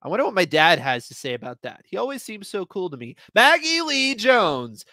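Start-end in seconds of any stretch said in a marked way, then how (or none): 3.3-4.8 loud, high, slow full-name call; impatient teacher call. Acted. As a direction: spell out each name, losing patience. Teacher's impatient third roll call.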